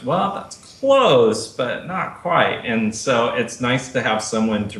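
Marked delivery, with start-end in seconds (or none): 0.0-2.6 slow, higher, gently correcting coach voice; a patient teacher nudging, drawn out slowly; imitating Tatiana. Tatiana correcting Mark's Russian, slow mock teacher voice. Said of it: "Tatiana goes".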